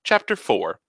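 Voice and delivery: ordinary delivery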